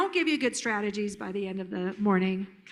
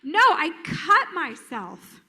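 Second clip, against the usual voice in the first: slow, emphatic mock protest; insistent hurt child; 3 semitones higher, 4 dB louder, much slower than usual. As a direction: stretch it like an unheard, upset kid. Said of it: "The child is gonna be like".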